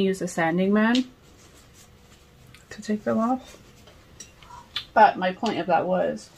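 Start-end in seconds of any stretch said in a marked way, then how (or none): none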